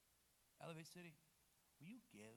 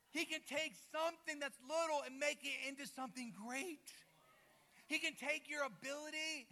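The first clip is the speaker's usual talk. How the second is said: loud, raised-pitch sermon build; full-force preaching; 8 semitones higher, 7 dB louder than usual. Acted. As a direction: preach it to the back row.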